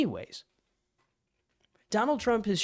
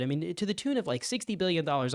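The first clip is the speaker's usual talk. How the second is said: ordinary delivery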